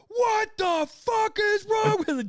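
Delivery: high, loud mock outrage, drawn out; berating shout. Players berating their teammate over frozen peas, loud shout. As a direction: yell at him in disbelief. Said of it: "just berated you".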